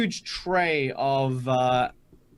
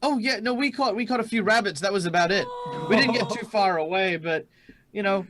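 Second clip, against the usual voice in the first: eager, higher-pitched chatter of an admirer; energetic fan; 6 semitones higher than usual. Acted. Young sweeper asking heroes how they found it, eager and high. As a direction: keep up the excited, tumbling fan voice. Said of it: "they're so energetic".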